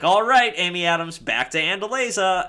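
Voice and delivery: hammed-up prince taking her home; Edward quote. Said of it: "Edward is like".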